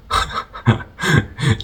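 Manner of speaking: short chuckle in bursts; amused laugh. Real, not acted. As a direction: laugh lightly at your own remark. Amused by word blasphemy.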